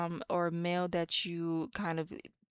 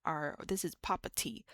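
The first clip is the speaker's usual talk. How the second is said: normal talking voice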